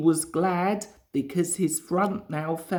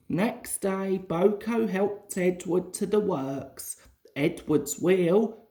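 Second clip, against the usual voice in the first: usual speaking voice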